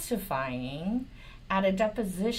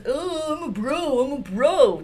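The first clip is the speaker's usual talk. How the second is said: mock bro voice; 6 semitones higher, 5 dB louder than usual. Mocking Patel as frat bro.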